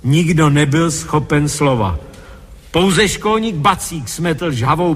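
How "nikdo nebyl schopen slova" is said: usual speaking voice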